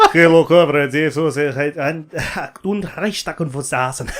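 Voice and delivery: loud laughing fake Russian sliding to German; mock Russian-German accent. Mocking his friend's drifting accent, laughing loud. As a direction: ham a comrade accent turning German. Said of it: "it's like".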